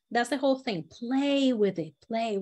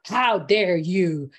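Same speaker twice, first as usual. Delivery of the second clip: "How dare you?" slow, low and firm; weighted outrage, dragged out; low indignant; 4 semitones lower, 4 dB louder, much slower than usual. Shown as a demonstration. Teacher showing the right way to say it. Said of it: "It's not".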